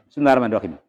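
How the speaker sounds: Bismillahirrahmanirrahim rattled off fast, letters swallowed; slurred Basmalah. Shown as a demonstration. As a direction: rush Bismillahirrahmanirrahim into an indistinct blur, like "sundarmandokim". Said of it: "ketika ada orang mengatakan atau mengucapkan".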